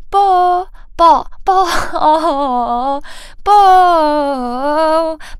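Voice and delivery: loud, high, bursting "bo"; popping bo. Shown as a demonstration. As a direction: explode each "bo". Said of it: "感觉是爆的感觉". (爆破感的bo)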